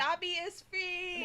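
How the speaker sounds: high, drawn-out proclamation; mock-triumphant cry. Friend imitating singer let go for solo, drawn-out mock triumph.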